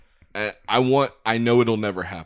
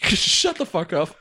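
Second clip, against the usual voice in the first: high laughing outburst; giggly mock outrage; laughing retort; 9 semitones higher, 4 dB louder than usual. Real, not acted.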